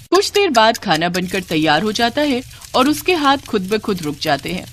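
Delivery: ordinary delivery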